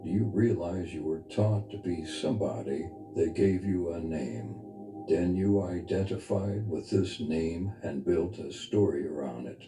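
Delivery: ordinary delivery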